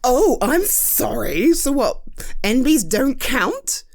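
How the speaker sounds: usual speaking voice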